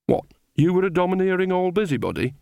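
high, innocent question. A narrator's reading. Nanny needling Granny, feigning innocence. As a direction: ask it as if butter wouldn't melt. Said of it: "said Nanny innocently".

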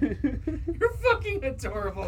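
high, loud, drawn out through laughter; real laughing delivery. Real, not acted. Friend amused by a stoned trademark story, laughing. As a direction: crack up while saying it.

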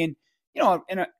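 usual speaking voice

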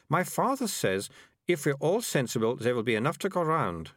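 put-on a bossy girl explaining things; prim girl. Melissa quoting her father.

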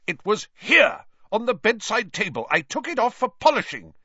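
high, loud angry shout. A narrator's reading. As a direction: shout in accusation. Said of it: "Percy was shouting".

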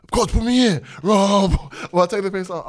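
high, loud mock kid scream; imitated screaming. Son screaming to coach from the sideline, imitated high-pitched yell. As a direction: holler it like a hyped-up boy on the sideline. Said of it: "He's screaming, like".

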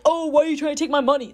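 fast, high-pitched mock outrage; exaggerated put-on indignant whine; rude customer. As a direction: imitate a customer rushing out an accusation. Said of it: "You know, not like".

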